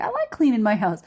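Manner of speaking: lightly imitated New York protest; arguing back. Boyfriend arguing with girlfriend, mock-defensive New York accent. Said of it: "argues with him about it".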